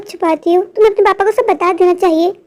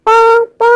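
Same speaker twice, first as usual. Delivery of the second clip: mock car horn; 3 semitones higher, much slower than usual. Car honks at kids in the road, playful horn imitation. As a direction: honk like a car horn with your mouth.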